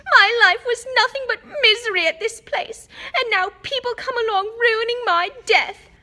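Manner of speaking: high, loud bitter howl. A narrator's reading. Ghost girl howls back at Hermione, bitter and loud. Myrtle wailing on.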